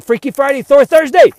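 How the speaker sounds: building to a high, loud shout; high note finish. Acted. Ending the video on a high note, building shout. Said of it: "gotta end on a high note".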